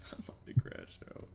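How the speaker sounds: muttered under breath; whispering. Real, not acted. Muttered frustration.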